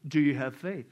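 low, unruffled question; calm heavenly voice. Acted. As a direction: ask calmly from above.

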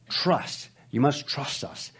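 put-on half-hearted German accent, slowly; bad German accent. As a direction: imitate a German speaker, badly and slowly. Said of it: "In that exciting German accent that I can't do".

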